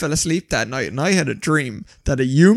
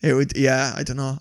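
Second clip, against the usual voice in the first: ordinary talk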